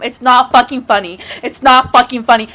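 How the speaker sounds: ordinary talk, nothing marked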